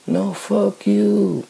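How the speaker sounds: slow it right down and throw it back at them; slow defiant retort. Real, not acted. Answering critics who say change how you talk, slow defiant fuck you. Defying people who police his speech.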